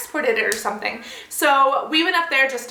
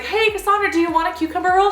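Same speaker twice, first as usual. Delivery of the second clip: high, bubbly sing-song offer; eager offer; 8 semitones higher, faster than usual. Retold or imitated. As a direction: brightly tempt her with a roll, faster at the end. Offering food to friend.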